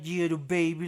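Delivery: put-on light, lilting baby talk to a dog. Shown as a demonstration. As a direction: coo it like talking to a puppy. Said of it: "like this".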